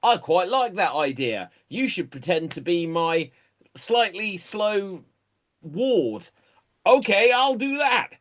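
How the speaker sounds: high-pitched, cheeky British swindlers bickering; hammed-up con artists.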